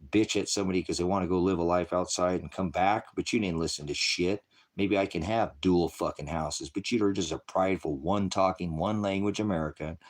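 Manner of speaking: usual speaking voice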